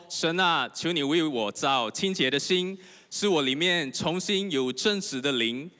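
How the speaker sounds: loud, slow, solemn reading of the psalm aloud; loud scripture reading. Acted. Pastor leading the congregation in reading, loud and slow. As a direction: lead the church through the verse, loud and slow.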